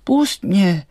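high, loud mock cry. A narrator's reading. Doll caught on the thistle, crying out high and loud. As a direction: cry out high and sharp to be released. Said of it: "vykřikla".

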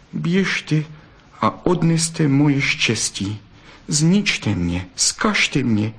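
sad. A narrator's reading. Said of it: "Zadíval se na ně smutně".

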